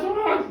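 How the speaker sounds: short high "ah"; mock scream. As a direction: yelp in comic terror. Shows his face while flying.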